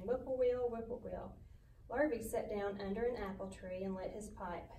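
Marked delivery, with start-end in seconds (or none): 0.0-1.3 bird call. A narrator's reading. A bird sings from across the road, lightly imitated. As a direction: call like a distant whippoorwill. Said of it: "a bird sang".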